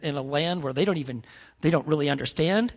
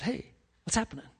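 soft, quick check-in; gentle concern; 3 semitones higher, 9 dB quieter, faster than usual. Retold or imitated. Youth pastor to crying high school boy, gently concerned. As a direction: retell a quiet, caring question.

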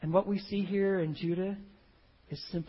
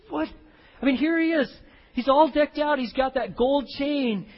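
amazed; 7 semitones higher, 6 dB louder than usual. Real, not acted. Preacher marvels at Joseph's disguise.

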